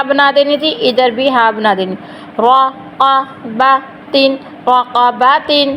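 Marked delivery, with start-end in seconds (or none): 2.4-5.7 very slow, syllable by syllable; full word with tanween. Shown as a demonstration. Teacher slowly spells out رقبتن before the pause form. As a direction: stretch out رقبتن slowly as raqabatin. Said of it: "وقف کیسے کرنا".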